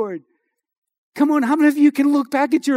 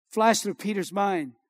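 ordinary talk

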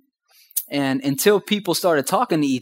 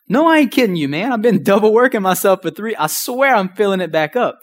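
higher and louder, worked-up protest; frustrated insisting; 6 semitones higher, 3 dB louder than usual. Retold or imitated. Protesting to Mister Tim about refilling the gas, higher and louder. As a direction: insist hard that you're not kidding.

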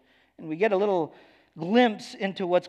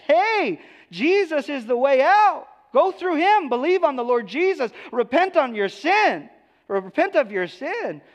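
much higher and louder, an urgent "Hey"; calling out; 10 semitones higher, 6 dB louder than usual. Acted. Believer calling a neighbor to Jesus, high urgent call.

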